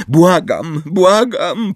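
high, rising, urgent plea; beg, pitch climbing; breathless pleading. A narrator's reading. Hermione begs the centaurs, short of breath. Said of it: "której wyraźnie brakło tchu".

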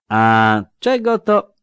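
mocking older soldier, drawn out; slow lisping veteran. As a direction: lisp it slowly with sly irony. Szczupak slow menace.

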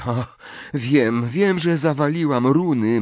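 worrying aloud in growing panic; feverish muttering. A narrator's reading. Hermiona frets over her runes exam, muttering feverishly. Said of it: "mamrotała gorączkowo Hermiona".

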